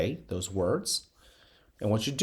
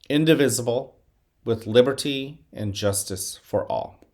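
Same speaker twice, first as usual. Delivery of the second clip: pledge recitation; 4 dB louder than usual. Acted. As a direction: lead the school through the pledge. Leads the Pledge of Allegiance.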